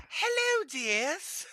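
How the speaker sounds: put-on high, lilting old lady hello; high sweet old lady. Barber greeting her customers, high sweet old lady voice. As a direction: croon it up high like a sweet grandma.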